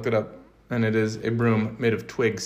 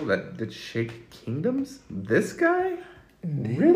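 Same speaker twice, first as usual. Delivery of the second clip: incredulous mockery; 4 semitones higher, slower than usual. Mocking Satan as puny.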